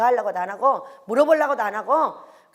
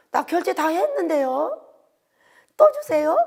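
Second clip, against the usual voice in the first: surprised son; 5 semitones higher, 3 dB louder, slower than usual. Retold or imitated.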